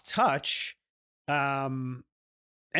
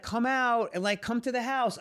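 mock friend invite; 8 semitones higher than usual. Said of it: "they're always like".

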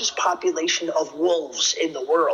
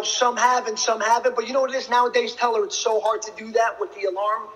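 ordinary talk, nothing marked

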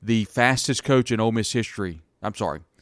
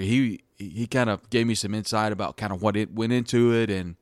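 usual speaking voice

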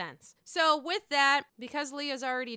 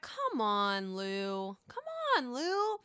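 exasperated; much slower than usual. Real, not acted. Mock exasperated plea.

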